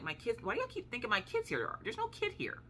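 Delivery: high-pitched, exasperated protest; acting out a friend's baffled denial.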